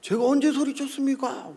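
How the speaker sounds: high-pitched denial; acted worked-up retort; indignant protest. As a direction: protest as if unaware of raising your voice. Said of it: "왜 나한테 소리치고 얘기해".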